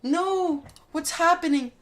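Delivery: a little bomb wailing as it explodes; mock panic. Gamer voicing a bomb wailing as it explodes, hammed-up panic.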